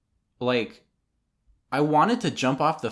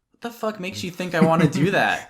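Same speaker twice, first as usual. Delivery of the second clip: higher and louder, incredulous scoff; indignant retort; 8 semitones higher, 6 dB louder than usual. Retold or imitated. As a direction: throw it back at the pushy dad. Retelling his retort to dad.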